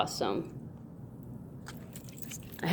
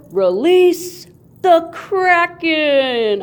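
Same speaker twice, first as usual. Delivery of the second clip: slow, booming higher epic proclamation; epic movie quote; 8 semitones higher, 5 dB louder, much slower than usual. Pretend. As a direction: announce it like a blockbuster villain. Dramatic movie quote over coin.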